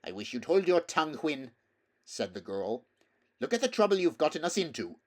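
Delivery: put-on the girl scolding her horse Hwin; irritated girl. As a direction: snap at the mare in annoyance.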